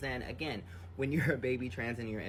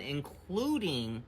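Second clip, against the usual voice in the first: usual speaking voice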